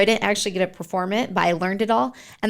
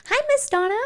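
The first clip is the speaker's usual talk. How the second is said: much higher, put-on greeting voice; acted fan greeting; 13 semitones higher than usual. Interviewer mimics people greeting the local celebrity, high-pitched imitation. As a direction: mimic an admirer calling hello in a high voice.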